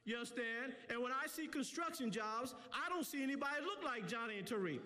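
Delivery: impassioned community preacher; fired-up speech from the pulpit; preaching. Real, not acted. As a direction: drive each point home to the crowd in rolling phrases.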